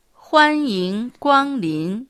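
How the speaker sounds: slow, clear model pronunciation of 欢迎光臨; teacher's slow demo of 欢迎光臨; slow model phrase. Shown as a demonstration. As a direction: say 欢迎光臨 slowly for learners to copy. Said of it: "大きな声で元気よくいらっしゃいませ。".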